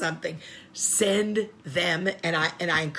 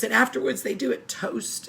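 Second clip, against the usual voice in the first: usual speaking voice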